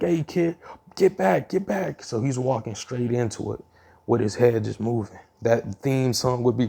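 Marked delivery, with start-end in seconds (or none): 0.0-0.6 much higher panicked call; frantic bystander calling out; alarmed shout. Acted. Bystanders warn kid off. 1.0-1.5 much higher, louder warning cry; frantic yell of get back; alarmed shout. Acted. Bystanders warn kid off. 1.5-2.0 much higher warning, dropping in level; pleading second get back; alarmed shout. Acted. Bystanders warn kid off.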